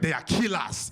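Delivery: put-on emphatic African father accent; African dad accent. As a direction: pronounce it gravely with an African accent. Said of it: "my dad's African".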